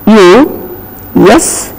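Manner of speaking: normal talking voice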